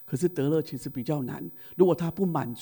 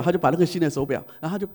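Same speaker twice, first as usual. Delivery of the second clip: ordinary talk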